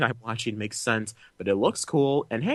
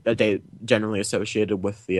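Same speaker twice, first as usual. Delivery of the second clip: usual speaking voice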